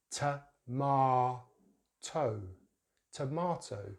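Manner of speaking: "tomato" as tuh-MAH-toh; "tomato" in syllables, then whole; British pronunciation. Shown as a demonstration. Teacher shows long vowel, "tomato" with ah. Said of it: "we're using the long vowel sound".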